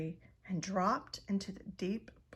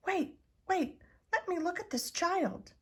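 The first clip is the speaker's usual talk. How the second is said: higher, urgent mother bat; eager mother bat; 10 semitones higher than usual. Acted. Mother Bat pushing through the crowd, higher and urgent. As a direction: push forward, needing to see.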